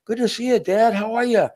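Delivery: put-on high, sweet child voice; little kid voice. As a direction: greet Dad like a cheerful little boy. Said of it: "Little Joy".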